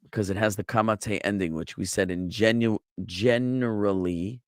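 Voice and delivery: usual speaking voice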